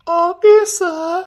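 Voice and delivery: high, very slow sugary okay; hammed-up falsetto lady voice; put-on girly voice. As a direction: coo it like a coy young woman. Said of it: "சீ, உன் குரல் நல்லாவே இல்ல".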